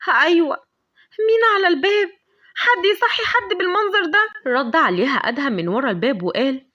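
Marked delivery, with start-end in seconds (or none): 0.1-4.5 high, loud startled call through the door; jolted from sleep and calling out alarmed; startled awake. A narrator's reading. Lavender woken at dawn, calling at the door, startled and high. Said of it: "صحيت لافندر مخضوضة".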